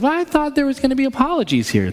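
put-on high, quick child's complaint; little girl voice. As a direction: pipe up like a let-down kid. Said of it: "And the daughter says".

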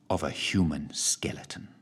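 eerie hushed whisper; whispered reveal. A narrator's reading. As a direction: drop to a spooky whisper. Grisly reveal in story.

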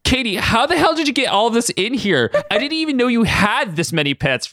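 high, stunned outcry; cried out. A narrator's reading. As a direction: exclaim in disbelief. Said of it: "Maddie cried out".

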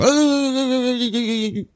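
high, wavering sustained buzz-squeal; mock electrocution noise. Shown as a demonstration. Co-host showing how to sell the electricity, mock electrocution noise. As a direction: fake being zapped with a long high-pitched wail. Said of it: "even if it's not, you gotta go".

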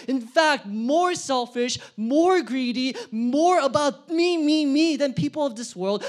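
preaching crescendo. Real, not acted. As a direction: pound each phrase home. Preacher building to climax.

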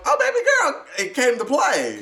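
high, loud mock cheer; hyped-up cheering. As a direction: squeal it like you're hyping her up.

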